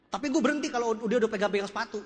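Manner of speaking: ordinary talk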